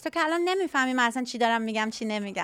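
rapid-fire Persian; very fast, running Persian patter; fast Farsi. Shown as a demonstration. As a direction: rattle it off in Farsi. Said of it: "you obviously spoke faster".

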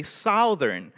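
"Southern" with the "ou" of "south", sow-thern, slow; Filipino way of saying it. Shown as a demonstration. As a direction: say "Southern" like "South" plus "ern". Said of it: "we say, in the Philippines".

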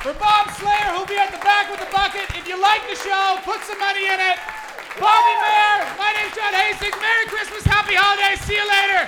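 very loud, high emcee call over the crowd; closing show announcement. Real, not acted. Comedian thanking the crowd at the end, loud emcee shout. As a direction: shout the sign-off to the whole room.

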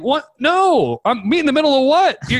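high-pitched incredulous protest; outraged disbelief. Retold or imitated. Buyer rejects meeting in the middle, outraged. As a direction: balk at it, voice shooting up high.

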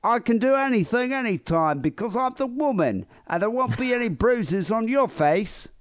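high put-on chav girl voice. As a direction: play the brash chav girlfriend. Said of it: "like, a girl Chab voice".

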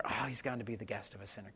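mock disapproving murmur; mutter. A narrator's reading. Crowd grumbling about Jesus and Zacchaeus, muttering. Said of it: "began to mutter".

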